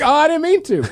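high-pitched put-on British accent; accidental British accent. Excusing himself to someone in a pub, mock British accent. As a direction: slip into a high, apologetic London accent. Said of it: "I just did it right there".

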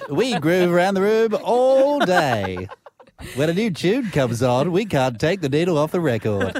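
loud, drawn-out hammy announcer; put-on show voice. Co-host hamming up a show sponsor read, put-on voice. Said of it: "Do you do lots of voices?".

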